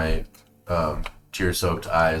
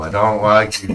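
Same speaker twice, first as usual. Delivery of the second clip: laughing; 4 semitones higher, 8 dB louder than usual. Acted. Sulky child character laughing.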